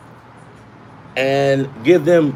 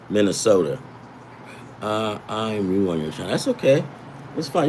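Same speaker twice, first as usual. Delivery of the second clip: ordinary delivery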